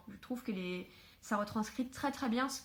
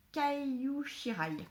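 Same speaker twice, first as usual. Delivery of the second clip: slow, higher attempt at a Japanese accent; bad Japanese accent; 5 semitones higher, much slower than usual. Shown as a demonstration. Vlogger names a manga author in her admittedly poor Japanese accent. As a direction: say the name in a clumsy Japanese accent, drawn out. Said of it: "mon accent japonais est toujours aussi mauvais".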